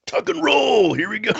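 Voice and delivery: high playful holler; mock action shout. Friend teasing about the drive, high mock holler. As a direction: call it out high for a daredevil leap. Imagined shout from a moving car.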